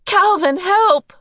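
very high, loud cries for help; piercing panicked call; crying out. A narrator's reading. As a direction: scream for rescue in panic. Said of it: "Meg cried".

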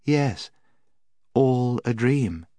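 high, slow, entranced echo; Jill. A narrator's reading. Enchanted Jill echoes the witch.

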